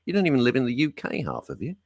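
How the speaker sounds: usual speaking voice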